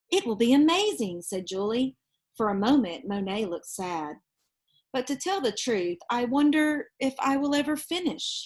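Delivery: put-on lively read-aloud voice for children; storybook reading. Reading a picture book aloud to children, lively storytelling. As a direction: tell it like a bedtime picture book.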